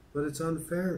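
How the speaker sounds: put-on high, mildly protesting child; crying out. A narrator's reading. Fern objects to her father's decision, crying out mildly. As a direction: raise the pitch in a read-aloud protest. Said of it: "cried Fern".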